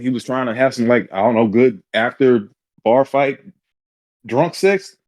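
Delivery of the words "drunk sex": usual speaking voice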